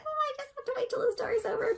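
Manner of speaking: high, soft, quick character voice; small high voice, hurried and quiet; patient listener character. Acted. Character waiting till the story's over, soft high aside.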